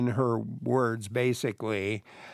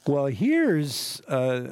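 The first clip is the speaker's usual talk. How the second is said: usual speaking voice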